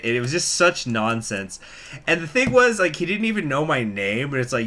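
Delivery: usual speaking voice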